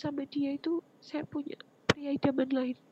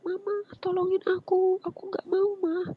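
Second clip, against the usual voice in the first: high, louder plea for help; retold desperate wail, pitched up; imitated child's scream; 5 semitones higher, 5 dB louder than usual. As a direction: cry out like a child begging mom. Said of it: "anakku teriak-teriak terus sampai bilang".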